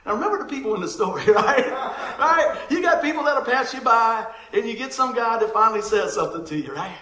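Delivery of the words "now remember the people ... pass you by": ordinary delivery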